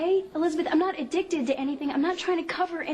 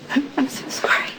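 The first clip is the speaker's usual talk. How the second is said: shaken apology; 4 dB louder than usual. Acted. Emily apologizes after breakdown.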